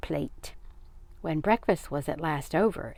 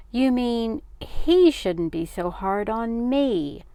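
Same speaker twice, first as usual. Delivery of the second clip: higher, louder, drawn-out indignation; angry retort; 6 semitones higher, 6 dB louder, slower than usual. A narrator's reading. Father complaining to mother about the boy, angry retort. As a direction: slowly lean on the grievance. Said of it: "angrily ripping a piece of bread".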